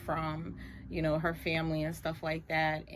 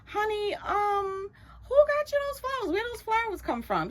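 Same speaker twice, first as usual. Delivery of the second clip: nosy mother; 14 semitones higher than usual. Retold or imitated. Mother quizzing daughter about flowers.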